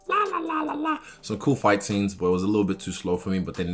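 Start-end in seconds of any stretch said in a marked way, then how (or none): 0.1-1.0 put-on high cute anime girl. Mocks a childish, slow show.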